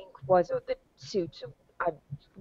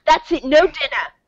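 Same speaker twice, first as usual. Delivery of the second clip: scolding; 5 semitones higher, 8 dB louder than usual. Acted. Scolding pet squirrel.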